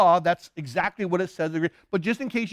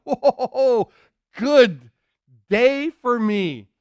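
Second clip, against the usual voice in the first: loud, higher, drawn-out delight; excited reaction; 4 semitones higher, 6 dB louder, much slower than usual. Acted. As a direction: savour the good news out loud. Blind man hears Jesus is coming.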